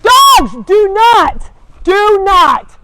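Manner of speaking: real high, very loud command; full-force yell to stop misbehaving dogs; shouted scolding. Real, not acted.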